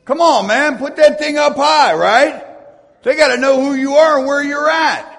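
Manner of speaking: high, loud, driving exhortation; urging shout. Real, not acted. Preacher urging the crowd to raise their lights, loud and high. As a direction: push them hard to hold the light up.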